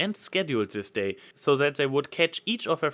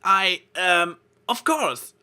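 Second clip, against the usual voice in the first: flustered; 7 semitones higher, 5 dB louder than usual. A narrator's reading. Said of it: "her confusion turning into pride".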